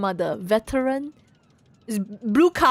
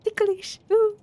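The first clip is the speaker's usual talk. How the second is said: high squeal; 11 semitones higher, 4 dB louder, slower than usual. Retold or imitated. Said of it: "you'll be like".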